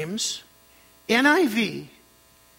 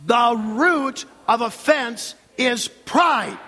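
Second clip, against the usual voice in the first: very slow build to a forceful "pride"; strong stress on pride; 3 semitones higher, 4 dB louder, much slower than usual. Shown as a demonstration. Preacher drilling the congregation, strong final stress on "pride". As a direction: set "pride" apart and hit it hard. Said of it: "strong emphasis on the last word".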